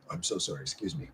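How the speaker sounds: normal talking voice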